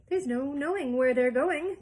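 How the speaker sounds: loud, gleeful singsong; hooting glee. Acted. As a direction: crow it with glee. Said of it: "hooting with laughter".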